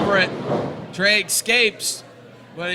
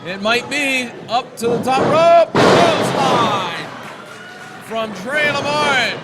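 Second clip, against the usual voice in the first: wrestling commentator; 2 semitones higher than usual. Real, not acted. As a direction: call every move as it lands. Live play-by-play of wrestling match.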